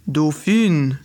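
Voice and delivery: a mage addressing the dolphin fairy, grave and low; old mage. Acted.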